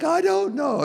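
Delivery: high, loud mock wail of a helpless mom; mock crying parent. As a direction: wail it like a defeated mother about to cry. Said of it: "You start crying".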